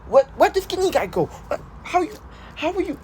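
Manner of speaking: squeaky, very high stammered shock; gasp, then sputter in a high squeak; Deku. Acted. Student baffled by the hero's transformation, high-pitched flustered stammer. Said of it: "Deku would be like".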